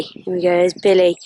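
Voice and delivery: ordinary talk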